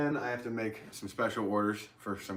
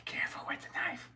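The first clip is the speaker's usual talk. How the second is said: whispered warning to himself; hushed, breathy aside; 6 dB quieter than usual. Pretend.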